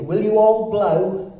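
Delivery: loud, slow and drawn out. Shown as a demonstration. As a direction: ask again so the room hears. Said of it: "Sorry, I didn't hear you".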